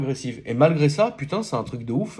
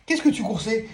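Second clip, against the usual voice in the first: pet talk; 9 semitones higher than usual. Real, not acted. Talking to dog.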